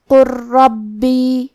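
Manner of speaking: slow drawn-out Quranic recitation of قر ربی; tajweed demo. Shown as a demonstration. Teacher demonstrating merged ra for students. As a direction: recite قر ربی slowly, the lam merged into a doubled ra. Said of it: "لہذا ہم حرف اللام کو را میں ملا کے".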